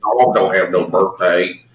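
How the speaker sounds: slow mock Southern drawl; Terrence Howard. Host mocking a movie accent, terrible Southern drawl. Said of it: "kind of terrible fucking Southern".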